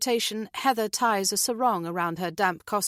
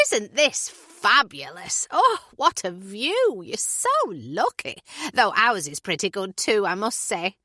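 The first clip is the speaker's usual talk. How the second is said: gushing Julie; 3 semitones higher, 4 dB louder than usual. Acted. Julie admiring the terrace view, slow and gushing. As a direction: savour the view aloud.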